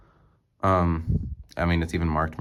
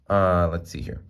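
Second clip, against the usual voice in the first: usual speaking voice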